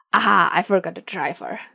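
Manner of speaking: loud, triumphant crow. A narrator's reading. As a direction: crow it like a sudden discovery. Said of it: "the leader crows".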